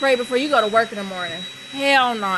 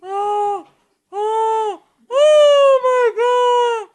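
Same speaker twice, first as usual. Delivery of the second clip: high wail swelling louder; near-tears wailing; 9 semitones higher, 5 dB louder, much slower than usual. Real, not acted. Freaked out by the spider, about to cry, high wailing. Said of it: "I'm about to cry".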